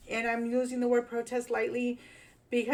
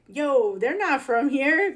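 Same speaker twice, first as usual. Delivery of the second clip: raised, punchy call-out of outsiders; loud retold "yo"; 5 semitones higher, 8 dB louder, slower than usual. Vlogger retelling his reaction to protesters, loud and sure. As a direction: bark it like you just clocked strangers. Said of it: "I was like".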